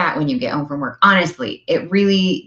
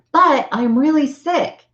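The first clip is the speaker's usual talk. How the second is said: whiny objection; 9 semitones higher, slower than usual. Retold or imitated. Imitating listener's objection.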